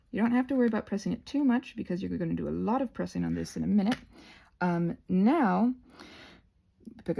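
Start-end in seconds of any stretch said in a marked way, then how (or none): none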